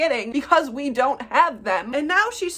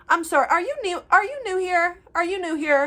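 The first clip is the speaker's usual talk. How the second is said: high, loud, pointed questioning; mock-incredulous, insistent asking; condescending customer imitation; 8 semitones higher, 5 dB louder than usual. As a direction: mimic a snotty customer, pitched up and loud.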